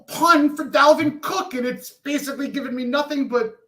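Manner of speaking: loud, high-pitched exasperated defence; annoyed. Real, not acted. Host defending his cooking pun, annoyed and loud. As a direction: raise your voice in annoyed self-justification. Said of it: "I'm annoyed and tired".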